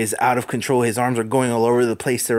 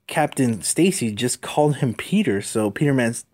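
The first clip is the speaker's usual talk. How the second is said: ordinary delivery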